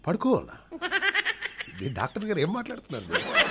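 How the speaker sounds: very high, scandalised, laughing squeal; shocked woman. Acted. Woman patient told to lie down, shocked and high. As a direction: squeal up in mock outrage.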